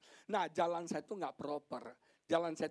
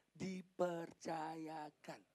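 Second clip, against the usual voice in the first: "dipercayakan" very slow and softer, each syllable separate; stress on DIPERCAYAKAN; 6 dB quieter, much slower than usual. Shown as a demonstration. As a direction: spell out "dipercayakan" slowly in a lowered volume. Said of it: "saya mau tekankan sini". ("dipercayakan" ditekankan pelan dan sangat lambat per suku kata)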